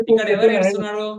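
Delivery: ordinary talk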